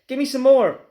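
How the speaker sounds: high, loud demand; demanding shout. Acted. Grandma demands another dose from George, shouting.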